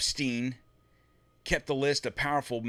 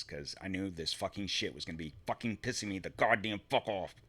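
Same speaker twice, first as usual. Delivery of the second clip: normal talking voice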